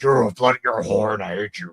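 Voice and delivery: garbled demonic ranting; growled Deadite tirade; possessed woman's rant. Acted. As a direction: rave like someone possessed. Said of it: "like, talking. She's like".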